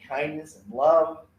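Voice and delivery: ordinary talk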